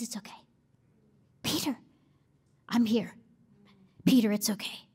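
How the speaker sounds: soft, hushed and tender, almost a whisper. Acted. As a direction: comfort him gently in a hug. Jesus comforting Peter in hug.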